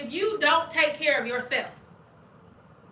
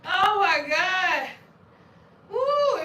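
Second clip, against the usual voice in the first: slow, loud, high whoop; laughing exclamation; 7 semitones higher, 7 dB louder, much slower than usual. Real, not acted. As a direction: drag it out while laughing. Recovering from laughter.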